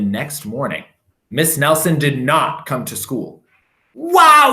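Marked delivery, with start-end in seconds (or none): 3.9-4.5 excited shout, high and loud; kids yelling. A narrator's reading. Kids cheering their teacher is gone, loud shout. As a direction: whoop it out like gleeful kids. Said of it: "yelled the kids".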